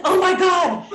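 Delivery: loud, high cry of amazement; excited outburst. Retold or imitated. Retelling her reaction to the email, excited. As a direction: burst out with a laugh at the edge.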